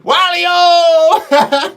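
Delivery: high, loud drawn-out whoop; playful holler. Real, not acted. Livestream host whoops an old call for fun, playful holler. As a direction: holler it long and high, breaking into laughter.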